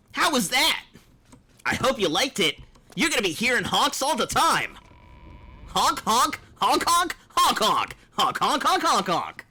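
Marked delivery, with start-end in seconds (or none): 5.7-9.5 goofy honking noises, higher; silly clown. Pretend. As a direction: honk like a clown horn.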